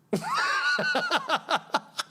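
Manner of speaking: long, very high laughing fit. Real, not acted. Streamer unable to start his Trump, laughing. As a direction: collapse into high-pitched giggles.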